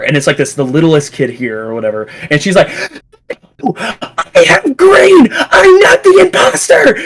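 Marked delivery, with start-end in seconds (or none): 3.6-7.0 very high-pitched mock screaming; imitated child screaming. Littlest kid protesting innocence in a game, high mock scream. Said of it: "screaming to the top of her lungs".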